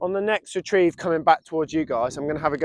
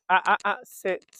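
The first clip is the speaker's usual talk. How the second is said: normal talking voice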